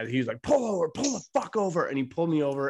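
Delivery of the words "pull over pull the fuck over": high-pitched mock yell; retold cop yell. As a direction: imitate a yelling cop without real volume. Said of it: "the guy was yelling at me".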